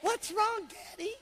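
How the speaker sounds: put-on high little kids asking; children ask their father what's wrong. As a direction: squeak it like small children. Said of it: "they go".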